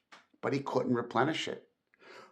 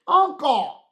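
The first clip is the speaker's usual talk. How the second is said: much higher, loud pleading cry; 13 semitones higher, 8 dB louder than usual. Acted. Nephew pleading with his raging uncle, high pleading cry. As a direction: beg him to stop. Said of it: "pleaded the nephew".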